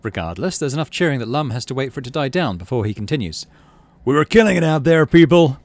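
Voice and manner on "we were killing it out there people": loud, higher, triumphant exclamation; rally the crowd at full volume; exclaiming. Acted. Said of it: "he exclaims".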